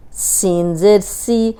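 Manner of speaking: سی said with a light whistling s, slowly; slow sin with zer. Shown as a demonstration. Teacher to students, a slow whistling سی. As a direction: draw out the سی with a sharp s. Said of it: "تینوں میں فرق ہے".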